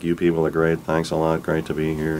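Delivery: low, soft, offhand stage banter; underplayed, trailing-off imitation of a casual frontman; ordinary talking voice. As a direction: mumble thanks to the crowd as if just chatting. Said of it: "talked like he normally does".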